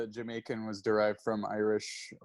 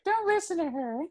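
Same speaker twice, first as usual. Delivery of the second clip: much higher put-on dad voice; dad's voice. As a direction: voice the dad, high and comic. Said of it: "my dad was like".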